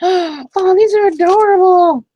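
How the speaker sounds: high, loud squeal of delight; high-pitched, loud cooing; delighted gushing. Real, not acted. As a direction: gush over something adorable. Delighted reaction to cute find.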